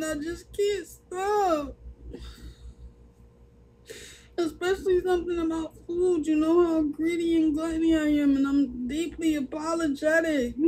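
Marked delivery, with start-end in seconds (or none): 0.0-8.4 real crying, admitting weakness; choked-up admission about food; crying confession. Real, not acted. Liz's tearful apology on live.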